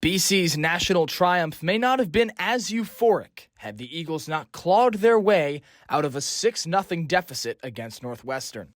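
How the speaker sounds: ordinary talk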